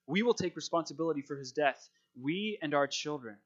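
ordinary talk

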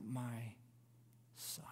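quiet mourning for a son; grief-stricken cry, subdued. Acted. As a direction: let the cry sink to a broken-hearted murmur. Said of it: "he cries out".

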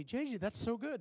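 high, loud gushing mom voice; proud mom. Retold or imitated. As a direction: gush like a proud mother at her kid's recital. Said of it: "my mom would come up to me".

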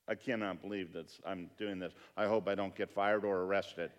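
low, hushed worried murmur to himself; muttering his nerves from the back of the room; anxious inner thought. Retold or imitated. Pastor at the back of the concert, fretting quietly.

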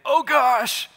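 high, loud mock panic; panicked exclamation. Kid who just crashed into the bully, panicked exclamation.

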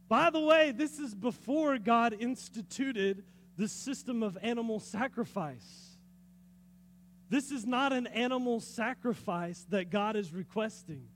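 usual speaking voice

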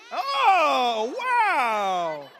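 loud, high drawn-out amazement; a big falling whoop of astonishment; exaggerated surprise. Real, not acted. Preacher reacting to kids who got in trouble, loudly amazed.

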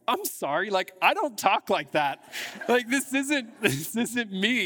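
retold flustered apology; embarrassed protest. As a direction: act out disowning your own accent, half laughing. Acting out catching himself in accent.

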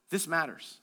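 low, soft and weighty; quiet emphasis. Real, not acted. Preacher urging them to listen to this, quiet emphasis. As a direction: drop low to make them lean in. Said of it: "Listen to this".

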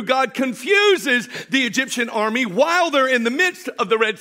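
loud, driving sermon climax; full-force preaching. Real, not acted. Pastor thundering the Exodus story to his congregation, full-force preaching. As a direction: preach it to the rafters, building the story. Preaches the Red Sea crossing.

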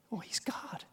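whispered realization; hushed awe. Retold or imitated. Disciple realizing who Jesus is, whispered awe. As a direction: breathe it out in awe. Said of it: "disciples' hushed realization".

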